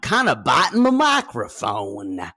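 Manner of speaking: loud, hammed-up actor impression; Gary Busey. Co-host showing off teeth at the microphone, hammed-up celebrity voice. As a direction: chew on the words, manic and loud. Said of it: "This is my Gary Busey".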